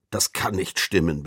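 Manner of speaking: usual speaking voice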